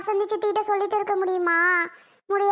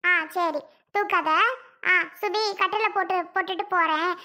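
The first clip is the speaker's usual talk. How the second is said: normal talking voice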